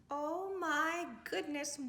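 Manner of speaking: usual speaking voice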